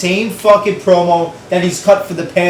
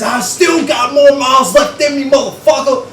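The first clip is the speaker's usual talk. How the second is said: retold shout; 8 semitones higher than usual. Retelling the wrestler's shocking heel turn, shouted. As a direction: bellow it like the wrestler turning heel. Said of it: "And he says".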